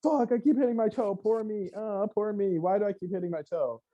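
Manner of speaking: high, whiny poor-me voice; mock self-pity. A host mocks people who keep hurting themselves, whiny self-pity. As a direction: whimper like a self-pitying victim. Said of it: "You're like".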